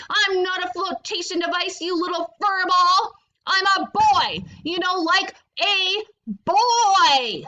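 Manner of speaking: exasperated and insistent; getting furious. Acted. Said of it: "getting furious with the rude".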